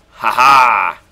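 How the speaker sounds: loud triumphant ha ha; gloating laugh. Real, not acted. Gamer gloating at his opponent, loud laugh. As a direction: crow over the kill.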